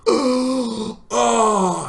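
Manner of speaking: loud, high exaggerated "oh"; mock dismayed gasp. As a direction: gasp and groan theatrically. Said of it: "And I'm like".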